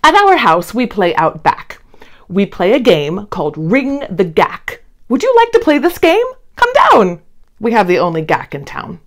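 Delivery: usual speaking voice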